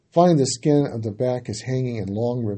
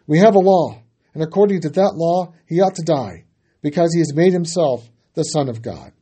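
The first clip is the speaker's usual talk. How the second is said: loud and higher, easing off; insistent answer; 4 semitones higher, 7 dB louder than usual. A narrator's reading. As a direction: start loud and high and let it settle.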